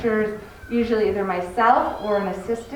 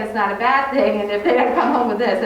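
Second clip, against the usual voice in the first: ordinary talk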